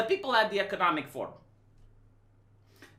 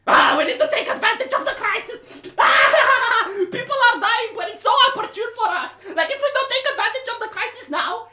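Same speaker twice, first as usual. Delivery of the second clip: much higher and louder, shouting through laughter; higher, louder, laughing manic glee; gleeful greedy villain; 9 semitones higher, 7 dB louder than usual. Retold or imitated. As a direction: cackle over the crisis like a cartoon villain. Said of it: "This is how I hear it".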